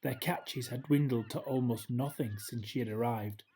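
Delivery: ordinary delivery, nothing marked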